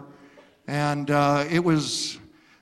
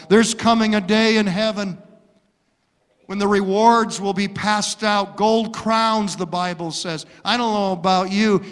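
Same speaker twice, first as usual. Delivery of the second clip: normal talking voice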